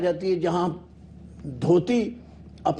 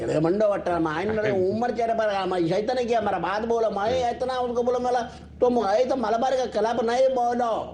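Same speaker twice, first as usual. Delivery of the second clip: mock Malabari accent; 3 semitones higher than usual. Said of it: "ایسے ہی ہے جیسے".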